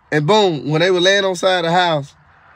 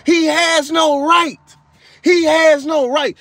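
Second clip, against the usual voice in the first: high, slow, furious demon shout; slow high shouted protest; 6 semitones higher, slower than usual. Retold or imitated. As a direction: bellow it like an enraged demon refusing to let go. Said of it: "The demon, like Kamala Harris just kept saying".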